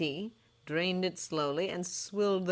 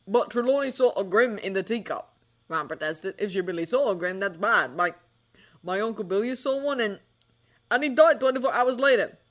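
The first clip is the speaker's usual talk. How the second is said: put-on protesting boy; 5 semitones higher, 7 dB louder than usual. Ron protests.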